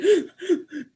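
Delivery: imitated hitching, high sobbing gasps; acted-out hysterical gasps; catching breath while crying. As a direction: mimic gasping for air between sobs. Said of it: "like, trying to catch my breath".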